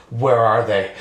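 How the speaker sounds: low, deadpan demand; mock cop-drama interrogation, pitched low; stern detective demand. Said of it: "grabs her arm and he goes".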